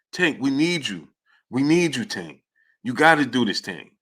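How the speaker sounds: soft, hushed pleading to his fighter in the corner; lightly voiced coach begging between rounds, drop the name Tank almost to nothing; imitated pleading. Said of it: "Floyd Mayweather was pleading with him".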